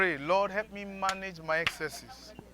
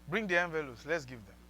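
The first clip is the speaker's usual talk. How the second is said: usual speaking voice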